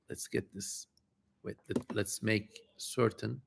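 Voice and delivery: whispered under the breath; hushed aside to self. Real, not acted. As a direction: whisper it to yourself while setting up. Muttering to self setting up.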